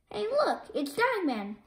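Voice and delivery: ordinary delivery, nothing marked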